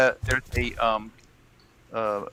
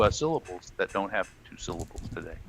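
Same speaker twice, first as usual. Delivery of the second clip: ordinary delivery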